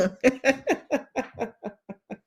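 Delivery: high soft laugh at her own joke; laughing at herself. Real, not acted. Host laughs after her Jamaican accent bit. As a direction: let a light laugh slip out.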